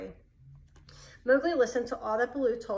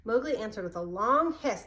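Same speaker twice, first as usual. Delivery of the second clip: ordinary delivery, nothing marked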